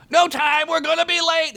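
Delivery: high hurried yell. Acted. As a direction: yell it while rolling away. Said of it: "he yelled".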